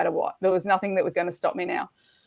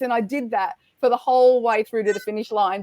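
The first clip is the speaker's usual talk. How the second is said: ordinary talk, nothing marked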